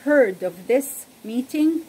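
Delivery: clear, measured Bible story reading; storyteller voice reading scripture; story narration. Acted. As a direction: tell the story to listeners, evenly paced. Reads Bible story aloud.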